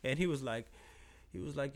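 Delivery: usual speaking voice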